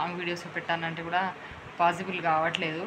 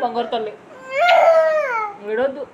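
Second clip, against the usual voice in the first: louder, higher coaxing voice; 10 semitones higher, 10 dB louder than usual. Real, not acted. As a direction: coax her out of tears, raising your voice over the crying. Mother soothing crying daughter.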